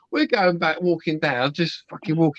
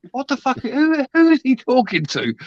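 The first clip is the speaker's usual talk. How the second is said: high-pitched retold bewilderment; baffled disbelief; 10 semitones higher than usual. Bystander wondering who he's talking to, baffled. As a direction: act out being baffled, pitched well up. Retells bafflement at ranting stranger.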